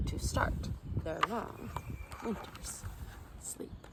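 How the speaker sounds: fading to a whisper. A narrator's reading. Narrator closing the story for sleepy children, fading whisper. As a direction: let it drift off to sleep.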